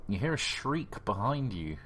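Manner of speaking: ordinary talk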